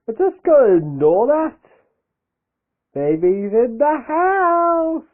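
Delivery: high, slow put-on voice; high character voice. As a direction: squeak it out slowly in a high voice. High put-on voice, far timbre.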